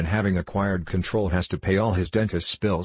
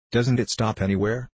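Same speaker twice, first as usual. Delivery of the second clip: usual speaking voice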